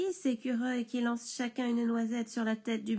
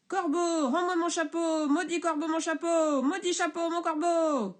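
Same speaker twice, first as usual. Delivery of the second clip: explosion de rage, aiguë et forte; éclate de colère; 7 semitones higher, 5 dB louder than usual. Acted. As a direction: crie sa fureur au corbeau. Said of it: "le bûcheron éclate". (le bûcheron éclate de rage)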